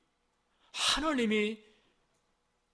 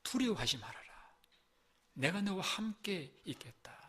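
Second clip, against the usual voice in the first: gentle voice of God; 6 dB quieter than usual. Acted. God speaking softly to his suffering son and daughter, tender hushed voice. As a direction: hush it like a loving father comforting a child. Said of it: "혹은".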